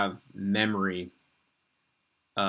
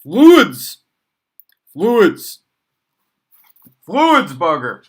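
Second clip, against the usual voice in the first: yelled New York accent, much higher and far louder, "Fluids" drawn out; 10 semitones higher, 20 dB louder, much slower than usual. Shown as a demonstration. Teacher hamming it up for the class, shouting in a New York accent. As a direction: belt "Fluids!" like a loud New Yorker hollering across the street. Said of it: "I like to say fluids in a New York accent".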